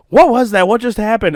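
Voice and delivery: high, loud alarmed questions; imitated wife. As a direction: play the wife running out alarmed. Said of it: "and she's like".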